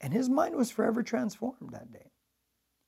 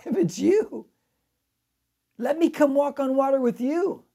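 Peter pleading; 7 semitones higher, 6 dB louder than usual. Retold or imitated. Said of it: "And he says".

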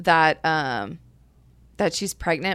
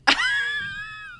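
shrieking laugh. Real, not acted. Disbelief at resolution.

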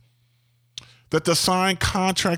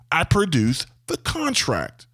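ordinary talk, nothing marked

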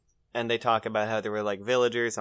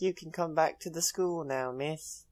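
high, soft imitated child voice; weird little boy; 5 semitones higher, 6 dB quieter than usual. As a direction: put on an eerie, polite small boy. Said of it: "the little weird boy's there".

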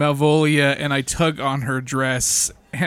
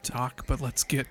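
soft, terse undertone; quiet, halting insistence; hushed reply; 5 semitones lower, 8 dB quieter than usual. Acted. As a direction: keep it private and close the subject. Answers the whispered private offer.

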